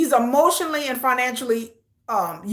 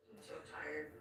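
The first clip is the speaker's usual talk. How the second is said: breathy whisper imitating Candace; mock her tired whisper; whispered quote; 10 semitones lower, 28 dB quieter than usual. Viewer whispers Candace's tired line to her audience. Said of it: "She like".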